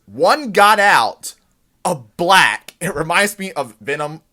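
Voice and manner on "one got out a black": slow loud drawn out yell; slow deliberate shouted alarm; mock yell. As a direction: bellow it at the fleeing woman. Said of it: "yells some words that are just crazy".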